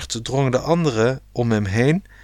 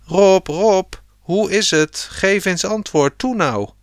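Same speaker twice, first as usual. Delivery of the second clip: high, urgent call, easing at the end; 7 semitones higher than usual. A narrator's reading. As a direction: call down to someone out of sight. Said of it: "dat er geroepen werd". (dringend en hoog roepend)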